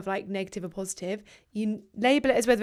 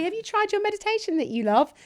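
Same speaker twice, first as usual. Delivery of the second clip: much higher mocking boyfriend voice; 11 semitones higher than usual. Girlfriend imitating boyfriend's meditation advice, high mocking voice. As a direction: send up his smug suggestion, pitched high. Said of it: "I don't want you to say".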